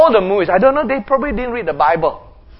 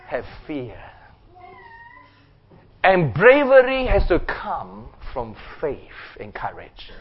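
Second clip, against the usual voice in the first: much softer, lower, slow earnest words; hushed conviction; 8 semitones lower, 7 dB quieter than usual. Real, not acted. Preacher quietly driving home faith to the congregation, hushed. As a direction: lean in and let it sink in. Preacher drops to intimate conclusion.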